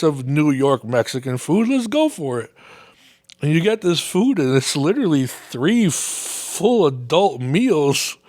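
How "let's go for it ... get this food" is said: ordinary talk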